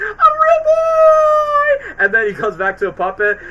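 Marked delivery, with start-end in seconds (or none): 0.0-1.9 very high, slow squealing shout; Pinocchio. Retold or imitated. Quoting Pinocchio's high shriek of joy. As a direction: shriek it like an overjoyed puppet. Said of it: "He's like".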